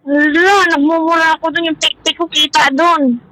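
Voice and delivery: ordinary delivery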